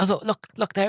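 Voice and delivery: high excited call, pointing something out. Retold or imitated. Retelling a thrilled actor showing kids something. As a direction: sound the alarm like a thrilled kid at play. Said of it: "he's like".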